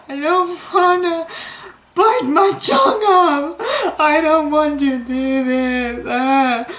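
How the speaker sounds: high, tearful, slow whimper; crying. Retold or imitated. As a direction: sob the words like a scared kid. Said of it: "crying on my bread roll".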